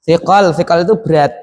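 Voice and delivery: ordinary talk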